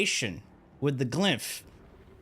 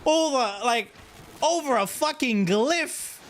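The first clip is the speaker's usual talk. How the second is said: exasperated; 10 semitones higher than usual. Real, not acted. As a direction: wail it in comic disbelief. Exasperated about glyph.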